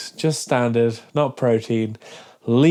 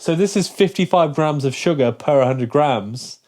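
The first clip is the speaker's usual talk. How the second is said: ordinary talk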